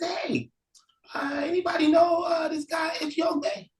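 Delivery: high, calling voice asking strangers; asking around. Acted. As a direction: shout out a question to the crowd. Brother asks townsfolk.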